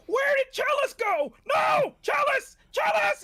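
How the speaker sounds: mock freak-out. Player fakes panic to sell the swap, high shrieking. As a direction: fake a frantic search, yelling her name. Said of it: "helped sell this by freaking out".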